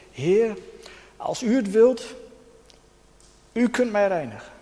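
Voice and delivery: smekend roepen, iets hoger: „Heer, als u het wilt”; roepende melaatse. Acted. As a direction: als een melaatse die om genezing smeekt. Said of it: "En hij roept tot Jezus". (de melaatse roept smekend tot Jezus)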